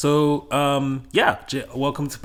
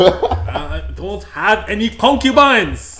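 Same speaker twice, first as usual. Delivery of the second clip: laughing protest; 4 semitones higher, 8 dB louder, much slower than usual. Real, not acted.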